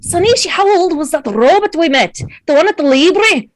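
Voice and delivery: put-on accent drifting from Scottish to Russian. [name] retaking the question on request, Scottish sliding into Russian accent. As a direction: roll from a Scottish burr into Russian. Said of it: "start off Scottish and end up, like, Russian".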